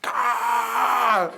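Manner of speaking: high, loud imitated cheering scream; crowd roar. Conference crowd answering his greeting, mock roaring scream. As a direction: scream like a wild concert audience. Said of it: "And people went".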